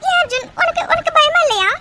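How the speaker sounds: high, upset protest; tearful panic. Acted. Girlfriend asks why he is not afraid, tearful panic. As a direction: plead in alarm, voice pitched high. Frightened girlfriend challenges calm boyfriend.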